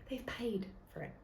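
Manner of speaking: a secret whispered aside. Real, not acted. Telling listeners the truth about TV slots, whispered. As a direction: let them in on a secret, whispering.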